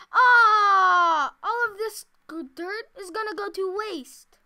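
loud, high wail of complaint; exaggerated dismay. Player lamenting wasted dirt, exaggerated dismay. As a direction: wail it out, long "ah" first.

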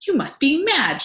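high, loud accusation; outraged cry. A narrator's reading. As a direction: cry it out in disbelief and anger. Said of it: "she cried".

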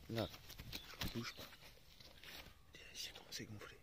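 soft whisper to the viewers. Real, not acted. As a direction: whisper so the dog stays still. Whispering near the dog.

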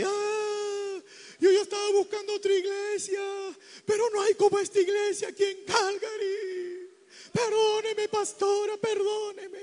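high mock wail, drawn out; imitated crying. Repentant sister hugging the pastor's wife, imitated crying. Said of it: "la hermana llorando y llorando".

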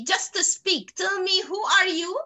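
ordinary delivery

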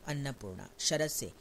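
ordinary delivery, nothing marked

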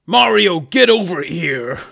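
loud commanding yell; Shadow. Acted. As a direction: order an ally over, stretching the last words. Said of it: "And then Shadow goes".

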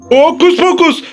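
normal talking voice

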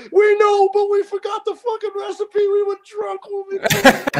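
high-pitched, shouted confession; mock excited shouting. Coworkers admit they forgot the drunken recipe, mock excited shouting. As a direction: call it out high, acting the excited guys.

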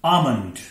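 आमंड without any l, AA-mund; silent l. Shown as a demonstration. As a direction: leave the l out of आमंड. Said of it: "एल silent होगा". (सही उच्चारण, ल silent, आमंड)

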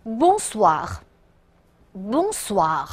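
slow, drawn-out Bonsoir with a long gap; slow read-along. Shown as a demonstration. Teacher asks the class to read after her, slow and careful. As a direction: model Bonsoir slowly for repetition. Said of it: "大家跟我读啊".

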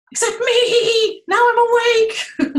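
high, loud laughing triumph; gleeful the only one awake; bear wide awake. Acted. Bear wide awake, friends asleep.

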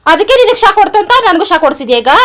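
loud, high-pitched accusing question; indignant outcry. Real, not acted. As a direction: confront your husband at full volume. Wife accuses husband after a shock.

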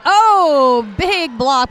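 sudden loud oh at a big hit; surprised. Real, not acted. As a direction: gasp out a loud oh. Commentator reacts to big hit.

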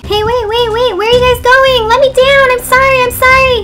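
loud, high begging yells; desperate pleading shout. Acted. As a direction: beg loudly to be let down. Monster begging to be let down.

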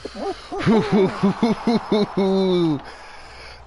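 high cackling laugh; burst of laughter. Real, not acted.

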